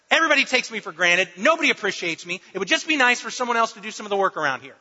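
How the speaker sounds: unload every grievance at once; mock attack mode. Upset spouse to partner watching TV, mock angry rant. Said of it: "your spouse is in attack mode".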